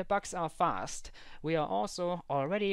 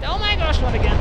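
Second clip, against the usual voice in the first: much higher, much louder cry; exasperated shout; 9 semitones higher, 16 dB louder, faster than usual. Real, not acted. Player trapped once more, high exasperated shout. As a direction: scream out the frustration.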